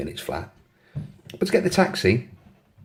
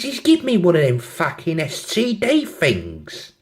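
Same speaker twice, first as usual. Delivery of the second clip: put-on slow, higher London accent; mock-indignant put-on London; 5 semitones higher, slower than usual. London girl complaining about her ex, London accent. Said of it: "have a go at the accents".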